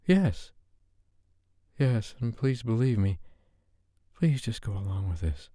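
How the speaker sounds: a shy woman begging, tripping over her words; nervous Sophie. Acted. Flustered Sophie asking Dave to turn off the lights.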